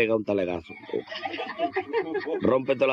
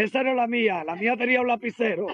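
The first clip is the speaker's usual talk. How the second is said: obrero agudo indignado; 10 semitones higher than usual. Retold or imitated. Remate del chiste.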